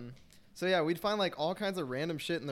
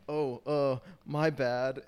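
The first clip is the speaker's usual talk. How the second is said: sheepish, halting mock apology; awkward apology; 4 dB louder, much slower than usual. Friend apologising to a stranger behind the kicked door, awkward. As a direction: back out of it awkwardly. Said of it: "he closed it so awkwardly".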